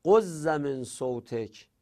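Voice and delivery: غض من صوتک said slowly, the doubled z held; slow model reading; slow demo. Shown as a demonstration. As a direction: dwell on the merged غض. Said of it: "میتونست بگه غض".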